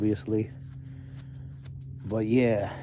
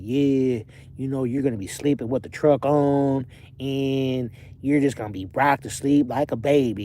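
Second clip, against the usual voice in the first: easygoing Southern accent impression; 2 semitones higher than usual. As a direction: do the trucking teacher's Southern accent. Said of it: "He talked with, like, that Southern accent".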